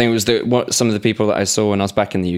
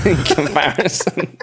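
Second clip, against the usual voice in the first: slow, higher, breaking into laughter; words giving way to laughter; laughing; 5 semitones higher, slower than usual. Real, not acted. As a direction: crack up while finishing the phrase. Cracks up at co-host's self-insult.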